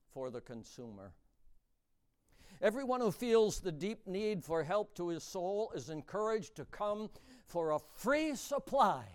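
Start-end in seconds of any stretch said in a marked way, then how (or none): none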